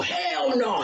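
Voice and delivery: ordinary delivery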